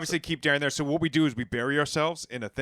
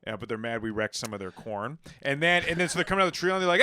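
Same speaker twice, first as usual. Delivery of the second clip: ordinary talk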